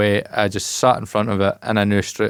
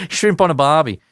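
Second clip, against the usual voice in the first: mock Aussie accent, pitched up; 6 semitones higher, 3 dB louder than usual. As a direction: have a go at a stock Aussie accent. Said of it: "I've always found it doing accents".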